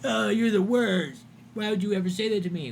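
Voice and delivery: mock a wounded chatter; hammed-up hurt whine; whiny mock. Mocking the upset chatter.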